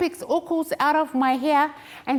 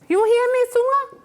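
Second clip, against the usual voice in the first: calling out; 10 semitones higher, 3 dB louder than usual. Acted. Calling out to Sunga.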